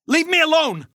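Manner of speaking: high, agitated shout; panicked, furious cry; angry outburst. Acted. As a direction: snap and yell to be left alone. Dazed man lashes out.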